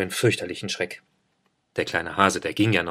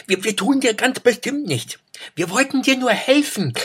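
much higher, a little louder, earnest; high hare voice; 3 dB louder than usual. A narrator's reading. Hare reassures Eida they mean no harm, high voice. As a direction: promise you mean no harm, as a small hare.

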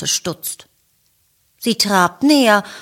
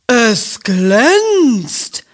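delighted moo; 4 semitones higher, 4 dB louder than usual. A narrator's reading. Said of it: "mut sie begeistert".

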